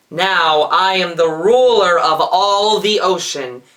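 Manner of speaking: loud, booming sea-witch claim; villain's declaration. A narrator's reading. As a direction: proclaim your power over the ocean. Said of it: "she declared".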